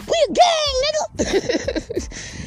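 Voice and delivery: high squeaky tough-guy dog; boasting little dog. Acted. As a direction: brag in a tiny cartoon squeak. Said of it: "the dog was like".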